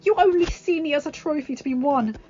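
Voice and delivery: high, loud and emphatic, slowing on key lines; impassioned rejection. Acted. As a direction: tell him off, then declare love. Belle rejects Gaston.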